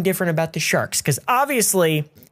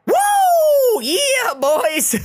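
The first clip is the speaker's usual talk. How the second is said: much higher, loud laughing whoop; excited whoop; 3 dB louder, much slower than usual. Real, not acted. Player launched by the Christmas tree, real joyful whoop. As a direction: let out a wild cheer of delight.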